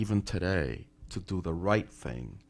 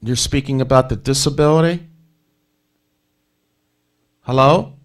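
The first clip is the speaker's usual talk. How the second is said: loud and higher, over a bad line; to someone hard of hearing; 5 semitones higher, 10 dB louder than usual. Real, not acted. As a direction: project clearly, as to a faint caller. Host straining to reach faint caller.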